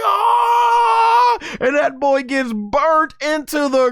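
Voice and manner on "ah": high, loud pained scream; villain screaming as he burns; villain's scream. Retold or imitated. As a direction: cry out as the blast hits. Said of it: "Goma's like".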